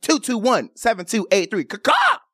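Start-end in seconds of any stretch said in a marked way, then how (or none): 1.6-2.2 very high "caw-caw" squawk; bird-call catchphrase. Shown as a demonstration. Radio host sounding a signature call, high bird squawk. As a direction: let out a high-pitched crow call. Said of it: "That is my call".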